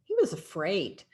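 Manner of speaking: plain reading voice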